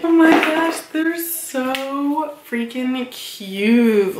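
high, stretched-out squeal of delight; excited gushing. Real, not acted. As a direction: gush over the cute headphones. Unboxing new headphones, gushing.

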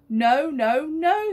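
put-on a father loudly cutting off his squabbling kids; firm father. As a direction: shut the argument down. Dad overruling the children.

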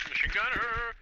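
high, loud warning shout; alarmed callout. Real, not acted. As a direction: yell the warning to the team. Spotting enemy gunner.

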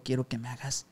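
soft breathy whisper fading out; a passenger whispering a proposition into the driver's ear. Retold or imitated. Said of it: "Así me lo decía en el oído".

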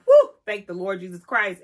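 loud, relieved exclamation. Retold or imitated. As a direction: feet sighing out in relief. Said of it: "my feet are like".

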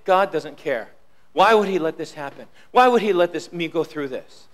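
low, quiet, despairing murmur; despairing doubter. Retold or imitated. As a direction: sink into doubt, voice dropping low. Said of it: "to say".

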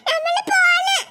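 put-on high wailing child's cry; tearful child calling out. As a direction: cry out like an upset little girl. Child wails leaving with mother.

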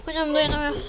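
words through a pinched nose; holding nose. Acted. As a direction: talk as if blocking a bad smell. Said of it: "osurdunuz galiba, kokuyor". (burnu tıkalı gibi)

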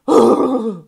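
big dramatic gasp; loud gasp. Acted. Reader hearing the Earth angel, big dramatic gasp. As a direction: gasp in theatrical shock. Spirit reader reacting to message.

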